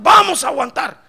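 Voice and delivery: loud, high shouted pledge; crowd's defiant answer. Acted. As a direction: shout back as a determined people. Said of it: "Y dijeron". (grito decidido del pueblo)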